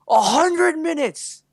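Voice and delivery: high, slow, loud mock screech; hammed-up outraged holler, words stretched out; imitated drunken yell. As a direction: yell it like a tipsy tiny woman. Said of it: "And she yells".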